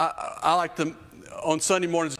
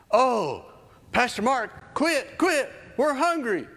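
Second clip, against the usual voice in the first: put-on high, louder childish whine; whiny hungry kids; 11 semitones higher, 3 dB louder, slower than usual. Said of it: "they're yelling at me".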